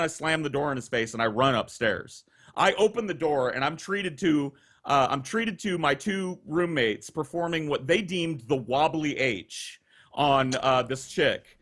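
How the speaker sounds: ordinary delivery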